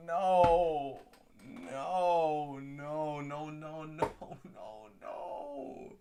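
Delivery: no repeated, dying away to nothing; fading despair. Real, not acted. Fan trailing off in despair after the trade. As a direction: let the denial fade out.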